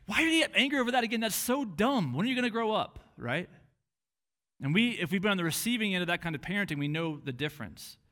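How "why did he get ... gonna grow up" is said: fast, loud, high exasperated scolding; impatient mock parental rant. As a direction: berate a child for the same mistake. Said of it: "totally different way of approaching a child".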